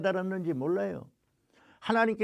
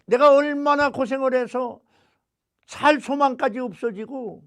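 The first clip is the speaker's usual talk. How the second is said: higher, louder anguished cry; anguished Paul; 6 semitones higher, 6 dB louder than usual. Retold or imitated. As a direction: cry out Paul's suffering. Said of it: "바울이 한 말 가운데".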